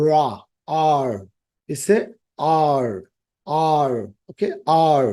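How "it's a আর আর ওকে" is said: আর drawn out very slowly, tongue not touching the palate; careful English R demo. Shown as a demonstration. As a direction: stretch আর slowly. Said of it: "জিহ্বাটা তালুতে লাগবে না".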